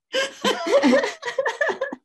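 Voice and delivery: high bursting laugh; big laugh. Real, not acted. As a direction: laugh out loud, high and free. Laughing at own story.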